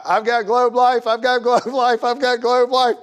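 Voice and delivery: high mock voice brushing him off; high, repeated brush-off of a door knocker; dismissive homeowner. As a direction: shoo the salesman away in a high put-on voice.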